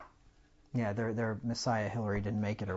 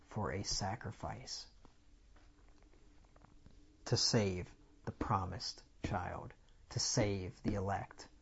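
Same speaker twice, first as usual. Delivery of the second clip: hushed whisper; 4 semitones lower, 10 dB quieter, slower than usual. Real, not acted. Preacher drops to dramatic hush.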